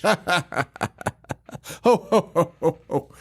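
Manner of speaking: hammed-up "ho, ho, ho" chuckle; spaced-out, theatrical ho-ho laughing; mock villain laugh. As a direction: imitate a killer laughing at his own pun. Imitating the villain's laugh after pun.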